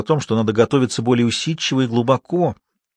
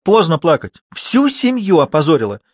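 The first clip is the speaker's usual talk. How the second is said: higher, indignant mother's scolding; acted maternal outrage; angry reproach; 5 semitones higher, 3 dB louder than usual. A narrator's reading. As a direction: berate the crying daughter. Mother berates crying daughter.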